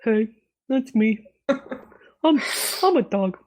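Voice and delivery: lightly voiced, halting dog; a hint of a slow-witted talking dog. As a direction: play a simple dog introducing himself. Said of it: "The dog voice is like".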